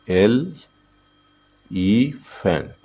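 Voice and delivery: "Elephant" very slowly as एल, ई, फेंट; syllable by syllable. Shown as a demonstration. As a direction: draw "elephant" out in three separated beats. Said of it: "elephant में कितने syllable है?". ("Elephant" को एल... ई... फेंट, तोड़कर)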